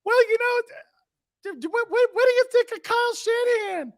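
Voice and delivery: squeaky falsetto with stammered starts; very high, hesitant caricature; high-pitched West Coast host. Shown as a demonstration. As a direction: ask it timidly in a mock San Francisco radio voice. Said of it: "I have to talk in that high-pitched voice".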